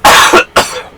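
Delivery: rough cough after a strained voice; throat-clearing cough. Real, not acted. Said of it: "rough on the old throat".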